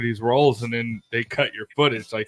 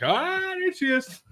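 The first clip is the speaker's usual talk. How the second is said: high, drawn-out squeal of recognition; mock excited spotting; 5 dB quieter, slower than usual. A viewer spotting her in Deadpool, mock excited. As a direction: stretch it out high, as if pointing her out.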